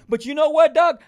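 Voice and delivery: loud, high call to Doug. Real, not acted. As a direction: grab Doug's attention with a sudden call. Calling out to viewer Doug.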